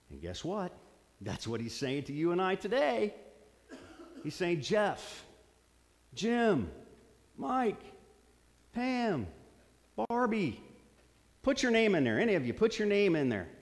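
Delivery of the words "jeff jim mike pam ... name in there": calling out names. Acted.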